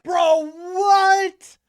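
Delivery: high, loud disbelieving yell; stunned shout. Real, not acted. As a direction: blurt it out in total shock. Stunned by the clip.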